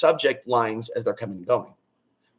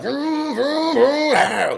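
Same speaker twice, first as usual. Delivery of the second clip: high, revved-up vroom vroom, mimicking a crazed driver; revving engine noise; 12 semitones higher, 3 dB louder, much slower than usual. Imitating people who drive crazy during solar flares, revving engine noise. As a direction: rev it like a wild car engine, high and pushed. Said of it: "Some people would be like".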